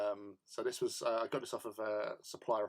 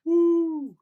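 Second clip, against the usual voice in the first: a big high whoop, much louder; celebratory whoop for the New Year; excited cheer; 13 dB louder than usual. Acted. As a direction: throw out a loud festive woo.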